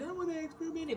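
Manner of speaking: high, soft whimper; whiny, pitiful self-mockery; mock crying. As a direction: imitate a tearful little plea. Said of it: "This is the first time I cried".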